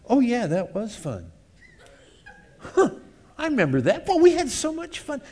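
high, gushing fond memory; nostalgic reminiscing. Acted. Satan's edited replay of good times, high gushing nostalgia. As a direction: relive the party with glee.

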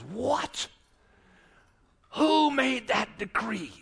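high, indignant outburst, drawn out; outraged disbelief. Acted. King learning his queen is threatened, outraged. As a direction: demand an answer in mock outrage.

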